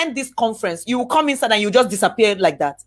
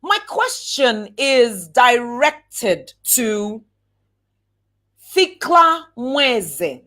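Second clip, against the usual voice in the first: slow formal question; much slower than usual. Shown as a demonstration. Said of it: "demo question".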